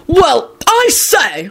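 high, drawn-out outraged cry; furious bellow. A narrator's reading. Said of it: "Arthur bellowed furiously at Kingsley".

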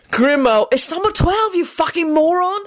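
high-pitched, exasperated outburst; exasperated correction. A narrator's reading. One marauder correcting the other's address, exasperated. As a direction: correct an idiot friend in disbelief. Friend corrects the wrong house number.

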